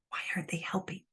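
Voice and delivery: hushed, breathy whispered question; whispered exasperation. Real, not acted. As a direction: drop to a bitter whisper.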